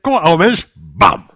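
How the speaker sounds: defiant challenge. Acted. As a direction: dare the old self to step up. Challenging the old self to fight.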